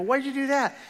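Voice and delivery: high, loud startled question. Retold or imitated. To a friend snapping his rubber band, startled. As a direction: jump in shock at the snap. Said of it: "I go".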